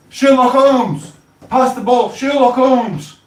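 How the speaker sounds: high, loud football shout; mock pitch shout. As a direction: yell across the pitch for the ball. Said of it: "running around the football pitch going".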